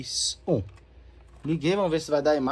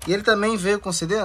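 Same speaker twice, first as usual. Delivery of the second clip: normal talking voice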